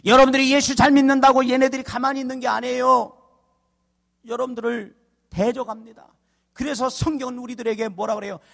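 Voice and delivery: ringing, measured pulpit oratory; forceful preaching. Real, not acted. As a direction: drive each phrase home to the congregation.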